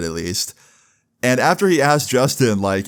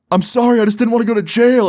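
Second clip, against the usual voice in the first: high-pitched, mock-panicked plea; tearful confession; 11 semitones higher, 4 dB louder, faster than usual. Boy caught lying to the cop, mock tearful pleading. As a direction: imitate a kid about to cry, voice pitched way up. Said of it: "he starts crying".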